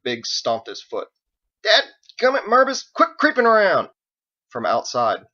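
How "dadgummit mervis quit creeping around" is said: loud, high exasperated yell; annoyed shout. Acted. Cowboy yells at a creeping neighbour, loud and exasperated. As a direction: yell at him to stop sneaking. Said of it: "he called out".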